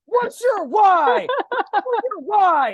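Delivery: high, drawn-out hammed-up shout; mock yelling. Peloton instructor yelling at the rider, mock shout. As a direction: holler each question slowly, like an overzealous instructor. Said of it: "she would yell at me".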